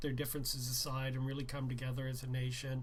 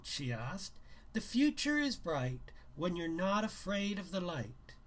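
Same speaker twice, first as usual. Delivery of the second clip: reading a novel aloud, a little livelier; brisk read-aloud narration; story narration; 4 semitones higher than usual. A narrator's reading. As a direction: tell the story to listeners. Reads novel aloud to viewers.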